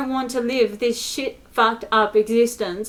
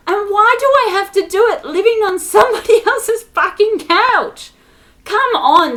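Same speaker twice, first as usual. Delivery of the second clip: higher, louder indignant protest; exasperated outburst; 7 semitones higher, 6 dB louder than usual. Real, not acted. Protesting having to live on someone's couch, exasperated. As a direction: protest with real exasperation, pitch jumping up. Frustrated rant at own situation.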